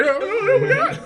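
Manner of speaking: high rising frightened whimper; mock scared. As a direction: play spooked by the storm. Scared by storm at night.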